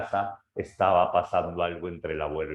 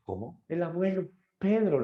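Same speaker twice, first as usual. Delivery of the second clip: surprised; 9 semitones higher than usual. Acted. Grandfather finds dog missing.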